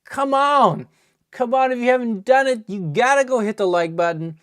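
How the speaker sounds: rally the viewers; excited hype. Real, not acted. Streamer urges viewers to hit like, excited hype. Urging viewers after the pull.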